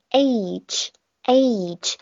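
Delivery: careful slow age; age demo. Shown as a demonstration. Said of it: "你要发合口双元音的A".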